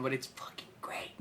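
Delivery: hushed whisper, almost no voice. Real, not acted. Telling a friend the movie is great, in a whisper. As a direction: breathe it out under your breath.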